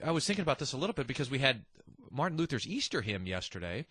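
usual speaking voice

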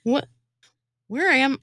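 ordinary talk, nothing marked

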